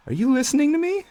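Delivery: very high, small plea; tiny pleading voice. Acted. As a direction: demand an answer from God. Nameless man calls to God.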